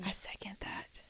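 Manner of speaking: very soft whispered agreement; conspiratorial whisper. Pretend. Co-host backing the laundry secret, in a playful whisper. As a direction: play along in a hushed whisper.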